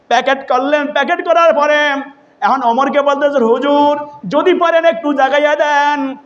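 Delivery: high sing-song sermon chant; melodic preaching. Retold or imitated. Preacher retelling Omar and his servant, melodic preaching. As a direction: chant the story high, stretching the line ends.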